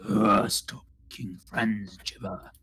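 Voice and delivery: slow, groaning monster grumble; grumpy left head. Pretend. Left head scolds the friendly twin, slow grumpy groan. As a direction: groan it out, fed up with your twin. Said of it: "just goes".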